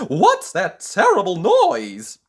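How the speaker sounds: loud, high, slow alarmed cry; frightened cry. Acted. Mister Jelly woken by a leaf, crying out in fright. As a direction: wake with a fright. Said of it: "he cried".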